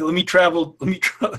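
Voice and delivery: ordinary talk, nothing marked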